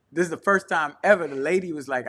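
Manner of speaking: ordinary delivery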